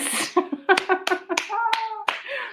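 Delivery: high, gleeful peal of laughter; real laugh. Real, not acted. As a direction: burst out laughing at the reveal. Laughs after revealing it's not yours.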